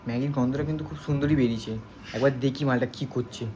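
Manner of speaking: hushed secret aside; whispering on the phone. Real, not acted. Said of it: "একটা চুপি চুপি কথা বলছি".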